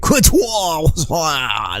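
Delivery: exaggerated fake French Quantuan, drawn out; milk the silly French word; mock French. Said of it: "me doing my French".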